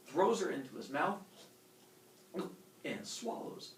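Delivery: normal talking voice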